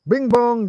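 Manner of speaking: high, loud sung two-note chime; mock alert chime. Streamer imitating a phone alert, high chime. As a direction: sing it like a phone alert ding.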